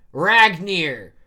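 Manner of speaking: loud, high, drawn-out battle call; yelling. Acted. Loki calls on his hammer in battle, yelling. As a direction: call the weapon's name at full volume. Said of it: "Loki jumps and yells".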